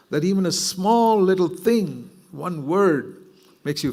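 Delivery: high, loud, urgent preaching; fervent preaching. Acted. Preacher urging the congregation not to fear, fervent. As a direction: preach it out with full conviction. Preacher rises to full force.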